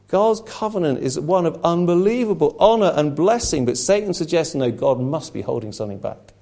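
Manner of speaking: ordinary talk, nothing marked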